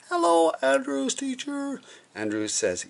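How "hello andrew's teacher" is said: very high, drawn-out hoot; embarrassing mum's singsong yell; yelled greeting. A narrator's reading. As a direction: holler it high and slow across a mall. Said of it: "his mom yelled".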